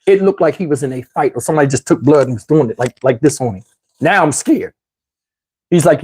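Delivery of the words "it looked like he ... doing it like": ordinary delivery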